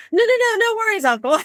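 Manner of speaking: high, hurried nervous promise; nervous reassurance. Retold or imitated. Frightened niece promising uncle silence, high nervous. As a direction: squeak out a scared promise. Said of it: "And I'm like".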